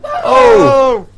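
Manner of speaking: squealed high oh; a hammed-up gasp; high loud oh. Mock surprise at goodbye.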